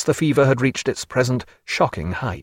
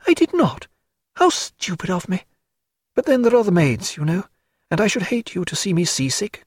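higher, lighter lady's voice, fond and fluttering; Diana's voice; 6 semitones higher than usual. Acted. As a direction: play a lively society lady fussing over a sick friend.

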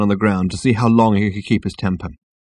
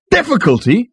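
high, loud rising question; incredulous exclamation; 5 dB louder than usual. A narrator's reading. Ford echoes the girl, exclaiming. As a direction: throw it back in disbelief. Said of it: "exclaimed Ford".

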